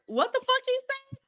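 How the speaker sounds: high bewildered question; way up high, totally lost; confused bullies. Retold or imitated. Bullies baffled by the smart kid, high and confused.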